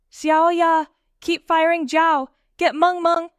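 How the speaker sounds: loud battle orders; yelling. A narrator's reading. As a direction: shout orders over the fight. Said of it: "Han Sen yelled".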